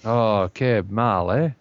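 a slow, put-on Spanish-sounding aside; slow mock Spanish. Co-host slips into a slow Spanish-style aside. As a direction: drawl it like a mock foreigner. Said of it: "I wish I understood what you just said".

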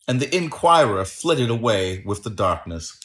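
usual speaking voice

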